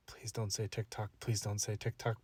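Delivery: very soft, low inner plea; silent pleading. Retold or imitated. Nervous date praying inwardly, very soft and low. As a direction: murmur the prayer under your breath. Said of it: "And I'm like".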